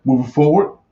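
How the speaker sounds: normal talking voice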